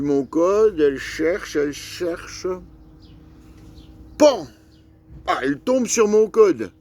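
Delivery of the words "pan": a sharp spoken bang for the bat's hit; imitated bang. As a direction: pop out « Pan ! » like a sudden knock. The bat bangs the keypad. (bruit de coup imité, sec)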